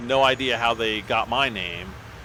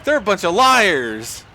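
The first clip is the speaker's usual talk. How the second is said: higher, slower, louder indignant retort; slow, outraged protest; 6 semitones higher, 6 dB louder than usual. Retold or imitated. Student to principal, indignantly calling friends liars.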